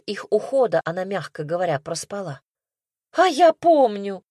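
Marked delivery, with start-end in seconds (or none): 3.1-4.2 high, loud scornful snort. A narrator's reading. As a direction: scoff it out loudly. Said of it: "фыркнула Люська".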